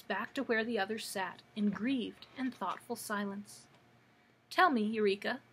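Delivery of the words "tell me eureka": plain reading voice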